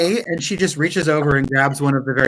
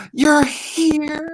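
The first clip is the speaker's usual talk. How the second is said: breathless alchemist; 13 semitones higher than usual. Acted. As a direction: gasp then much higher, awed whisper-like "you're here". Alchemist gasps in delight.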